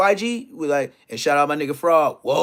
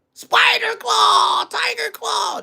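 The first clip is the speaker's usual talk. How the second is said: high, slow shouted call of moves; shouted move names; much slower than usual. Acted. Telling how Wack dug into Bosco, high slow shout. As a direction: yell it like a kung fu move. Naming a fighting move mid-story.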